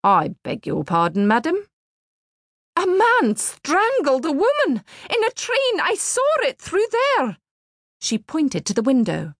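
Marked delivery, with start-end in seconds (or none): 0.0-1.7 puzzled collector. A narrator's reading. 2.7-7.4 very high, frantic insistence; urgent. A narrator's reading. Old lady repeating herself to the doubting collector, high and frantic.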